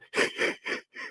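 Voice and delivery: imitated wheezing cartoon snicker; Muttley. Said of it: "You sound like Muttley".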